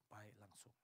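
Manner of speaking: ordinary talk, nothing marked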